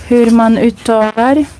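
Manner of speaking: ordinary talk, nothing marked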